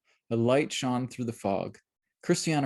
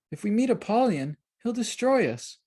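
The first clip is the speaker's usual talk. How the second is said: put-on child voice; 11 semitones higher than usual. A narrator's reading. Child character Eli worried.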